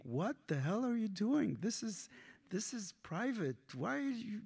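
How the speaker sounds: high, mock-indignant granny; mock grandma scolding. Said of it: "And I didn't speak like that".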